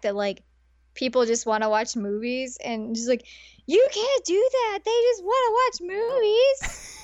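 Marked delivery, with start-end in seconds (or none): 3.7-7.0 high-pitched, drawn-out indignant whine; mock offended. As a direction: protest it high, as if scandalised. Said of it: "is just so freaking offended".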